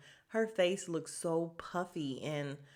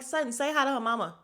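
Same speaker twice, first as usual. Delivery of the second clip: higher, louder and fast, telling Sutton off; exasperated urging; 7 semitones higher, 7 dB louder, faster than usual. Retold or imitated. Vlogger scolding Sutton at the screen, exasperated and fast. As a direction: scold her like a frustrated fan. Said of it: "and I'm like".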